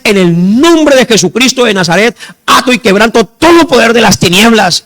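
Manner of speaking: loud, high commanding prayer; spiritual battle prayer. Retold or imitated. Husband praying against the powers of darkness, forceful command. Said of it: "a dar batalla espiritual".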